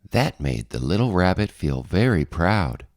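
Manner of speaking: ordinary delivery, nothing marked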